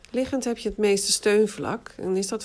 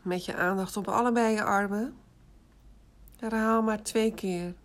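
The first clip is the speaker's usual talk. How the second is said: guided meditation. Acted. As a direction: guide them into rest, unhurried. Leads autogenic relaxation exercise. (rustige meditatiestem)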